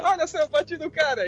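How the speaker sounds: put-on high, boastful kid voice; cocky Spider-Man. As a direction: brag like a cheeky teen. Mocking Spider-Man's boasting.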